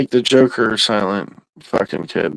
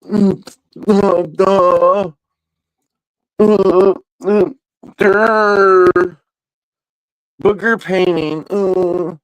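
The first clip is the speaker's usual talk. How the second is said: high, dopey "duh" voice; mock a brain-fried idiot; hammed-up dim-witted drawl; dumb person impression; 5 semitones higher, much slower than usual. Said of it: "And they're like".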